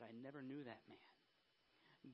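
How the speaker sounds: low, very soft and slow; retold hushed denial. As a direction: deny it quietly, as a retold quote. Retelling Peter's denial of Jesus.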